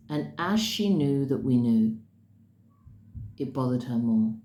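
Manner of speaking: ordinary delivery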